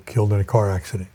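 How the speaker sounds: ordinary talk, nothing marked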